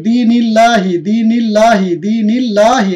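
দিনিল্লাহি with a thin light লাম, recited; recited model word. Shown as a demonstration. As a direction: chant it for students to repeat. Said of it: "আরো কিছু শব্দ দেখি". (পাতলা লামে পাঠ)

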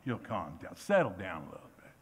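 an understated, patronising "settle down". Retold or imitated. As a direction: talk them down gently, half teasing. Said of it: "older Christians are like".

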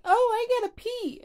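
high squeaky mock little-girl whine. Vlogger imitating the little girl in the anime, high squeaky mock whine. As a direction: squeak it like a small child. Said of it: "and she's like".

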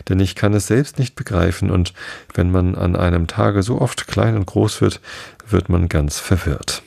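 plain reading voice

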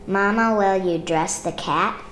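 ordinary delivery

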